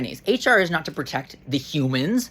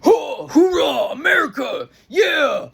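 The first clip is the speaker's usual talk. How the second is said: high, hammed-up cheer of a company hyping itself; mock patriotic cheer; 7 semitones higher than usual. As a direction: cheer "hoorah, America" like a rah-rah mascot. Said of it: "They're like".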